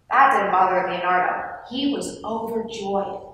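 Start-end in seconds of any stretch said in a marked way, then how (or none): none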